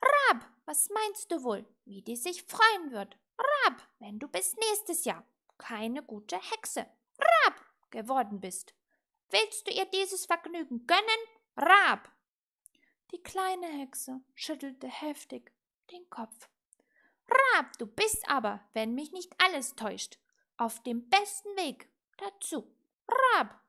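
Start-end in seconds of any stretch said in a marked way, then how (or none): 0.0-12.1 loud lecture broken by high caws; calling raven. A narrator's reading. Raven warning witch about next year, loud squawking. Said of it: "rief der Rabe". 17.3-23.6 loud, with high squawked caws; admonishing raven. A narrator's reading. Raven to little witch about failing, loud admonishing croak.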